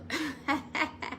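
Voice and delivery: soft laugh. Real, not acted. Laughs at own joke.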